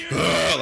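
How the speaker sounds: loud grunted ugh; gravelly grunt. Retold or imitated. Axe finishes the tag team promo with a loud grunt. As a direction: end the promo with a scary rasping grunt. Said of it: "Axe would come in with the".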